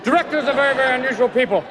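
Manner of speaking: ordinary delivery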